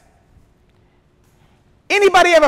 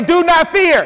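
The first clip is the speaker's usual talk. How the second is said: higher, ringing call to repeat; out-loud declaration; 5 semitones higher, slower than usual. Shown as a demonstration. Preacher urges congregation to face their situations, out-loud declaration. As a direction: proclaim it so the whole room echoes. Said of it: "you're gonna have to say that out loud".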